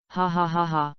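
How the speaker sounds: reads out ha ha ha as a light laugh; a light, half-read laugh; spoken laugh. A narrator's reading. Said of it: "talked and laughed together".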